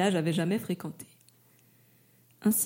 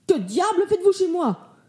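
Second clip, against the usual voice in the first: loud, high angry yell; furious shout; 7 semitones higher, 6 dB louder than usual. Acted. Mayor yells at stranger in his tent, furious shout. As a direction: bellow at the intruder in your garden. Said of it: "hurla monsieur Barthélemy".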